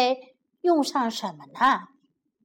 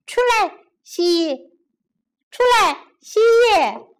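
much higher, louder calling; 大声呼唤蜥蜴; 9 semitones higher, 7 dB louder than usual. Acted. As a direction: shout for the hidden pet. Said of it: "he called out".

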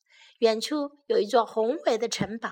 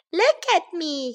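playful shout; 6 semitones higher than usual. A narrator's reading. Baby bear showing off in the leaves, high shout. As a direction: shout it proudly for attention. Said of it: "he shouted".

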